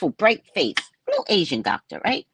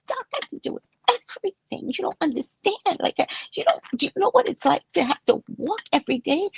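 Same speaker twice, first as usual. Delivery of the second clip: much higher, soft, mock-pleading; 9 semitones higher than usual. Patient whining up high to the doctor about walking. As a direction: whine it up high, hurt and earnest. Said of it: "Very soft voice".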